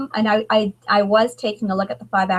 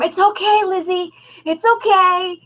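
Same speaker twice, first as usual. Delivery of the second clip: soothing mother; 10 semitones higher than usual. Retold or imitated.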